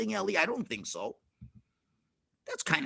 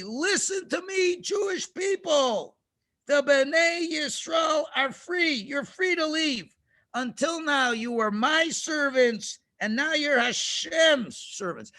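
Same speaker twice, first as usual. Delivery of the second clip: high, drawn-out proclamation; calling out announcement; 6 semitones higher than usual. Acted. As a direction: announce it as if to a whole city. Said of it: "He announced exactly".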